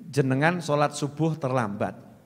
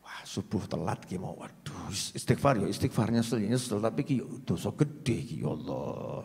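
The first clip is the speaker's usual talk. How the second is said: much lower, softer repentant voice; quiet remorseful murmur; 6 semitones lower, 9 dB quieter than usual. Acted. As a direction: murmur regret to yourself. Contrite inner monologue. (gumam penyesalan yang pelan dan rendah)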